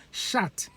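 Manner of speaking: "Shat" with a short flat a, the diphthong dropped; Benin "shat". Shown as a demonstration. Benin man saying shout as "Shat". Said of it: "He shout".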